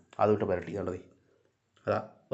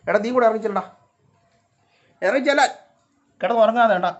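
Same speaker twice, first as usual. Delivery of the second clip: loud, high prodding; urging a pet; 9 semitones higher, 7 dB louder than usual. Real, not acted. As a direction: call the sleepy cat down to eat. Urging cat to go eat. (ഉറക്കെ നിർബന്ധിക്കുന്നു)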